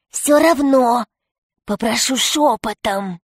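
hushed-voice demand from a rat pirate; rat villain. Acted. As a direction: insist on secrecy in a put-on rat voice. Крыс требует говорить шёпотом. (голосом крысы-злодея, требуя тишины)

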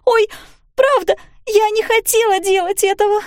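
put-on high, loud, panicked girl's voice; a frightened child crying out that it wasn't on purpose; cracking-voiced cry. A narrator's reading. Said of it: "кричала срывающимся голосом". (высокий громкий срывающийся детский крик)

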